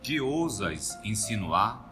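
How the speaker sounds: slow, tremulous challenge; inquirindo com esforço, apavorado. Acted. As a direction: ask it slowly with great effort, in dread. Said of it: "fez um esforço supremo e inquiriu". (pergunta lenta, com esforço e pavor)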